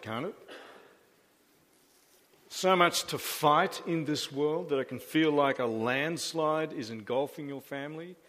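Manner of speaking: ordinary talk, nothing marked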